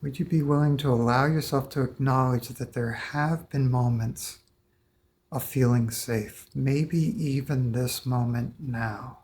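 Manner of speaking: guided meditation. Acted. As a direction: invite them gently, one step at a time. Guides client through release.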